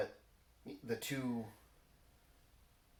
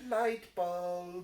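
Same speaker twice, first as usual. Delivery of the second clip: light bulb moment; 9 semitones higher, 6 dB louder than usual. Pretend. Mock idea moment.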